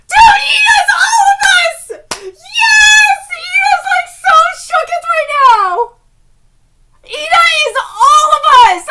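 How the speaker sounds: high, loud fangirl wailing; ecstatic shouting. Real, not acted. Fan relating to Ida's reaction, ecstatic shouting. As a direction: shout it out, overwhelmed with excitement.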